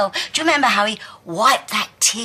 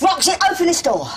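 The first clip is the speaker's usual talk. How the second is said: loud, fast, raised-pitch demand; shouted order; 7 semitones higher, 4 dB louder, faster than usual. Acted. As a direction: order it quickly through a locked door. Shouts through locked door.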